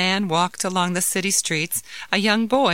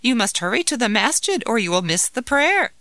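wife voice; 7 semitones higher than usual. Acted. Wife urging husband to pray.

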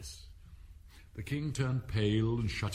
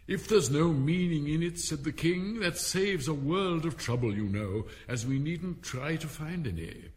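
higher, louder pompous king; 7 semitones higher, 4 dB louder than usual. Acted. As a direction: pronounce it grandly. King loud and high.

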